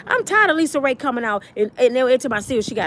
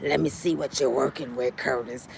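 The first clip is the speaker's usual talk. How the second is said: put-on very low breathy old lady; old woman purr; 12 semitones lower, 9 dB quieter than usual. Vivica greets a young man, low old-lady purr. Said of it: "She done turned to that".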